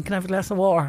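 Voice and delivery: a lightly put-on Dublin accent, "water" without the T; half-hearted go at a Dublin accent; Dublin accent attempt. Shown as a demonstration. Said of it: "I'm not very good at accents".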